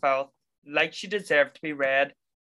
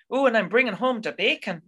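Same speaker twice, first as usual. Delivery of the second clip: mock bad Irish accent; 7 semitones higher than usual. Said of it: "that distracting".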